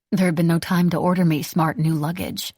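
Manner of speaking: ordinary delivery, nothing marked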